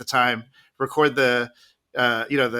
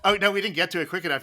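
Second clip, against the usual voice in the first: ordinary talk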